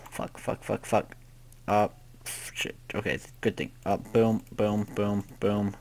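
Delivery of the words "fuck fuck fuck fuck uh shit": softer, breathless panicked whisper; whispered curses. Real, not acted.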